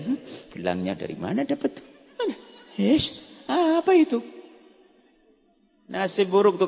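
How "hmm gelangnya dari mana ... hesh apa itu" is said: gossiping whisper. Retold or imitated. Gossiping women at study group.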